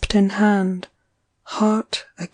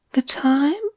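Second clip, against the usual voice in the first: higher, dreamy rising question; 7 semitones higher than usual. A narrator's reading. Eileen asks Archie dreamily, voice lifted high. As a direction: ask it as if half asleep.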